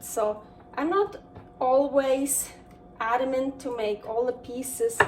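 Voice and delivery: ordinary delivery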